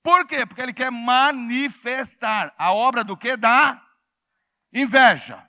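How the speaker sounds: loud, emphatic pulpit voice; full-force preaching. Real, not acted. Preacher warning the congregation about envy, full-force preaching.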